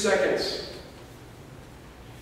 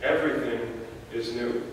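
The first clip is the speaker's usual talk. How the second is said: softer, lower restatement; 6 semitones lower, 7 dB quieter than usual. Acted. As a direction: drop to a soft, low hush. Repeats line softly after long pause.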